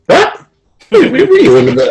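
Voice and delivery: high, disbelieving what are you on about; shocked pushback at the coach's accusation; incredulous outburst. Retold or imitated. Retelling his outburst at the new coach, high and incredulous. Retold outraged reply to coach.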